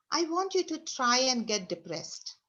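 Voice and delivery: usual speaking voice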